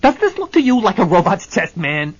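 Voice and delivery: loud, high blustering threats; ranting to his lawyer; furious Hennessy. Acted. Hennessy bares chest, threatens suit.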